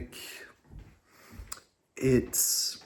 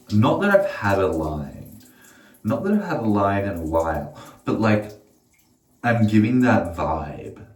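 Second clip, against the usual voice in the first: club-night chatter; 3 semitones lower, 8 dB louder than usual. Pretend. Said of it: "I actually talk like that".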